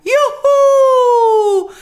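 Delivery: very high, long, loud whoop; shout of joy. A narrator's reading. Mayor hearing the water is back, joyous yell. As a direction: whoop with all the breath you have. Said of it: "il suo grido di gioia".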